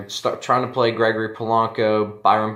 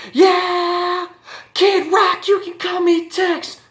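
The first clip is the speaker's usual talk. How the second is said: much higher, hammed-up rocker yell, slowing on the last words; [name] impression; 4 dB louder, much slower than usual. Host hams up [name] for listeners, high and yelling. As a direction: belt it like a rowdy rock star.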